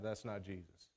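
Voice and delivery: dropped low and soft, flatly dismissing; quiet low rejection of a wrong claim; low dismissive aside. Retold or imitated. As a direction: mutter it under your breath like a private verdict. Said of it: "and I'm like".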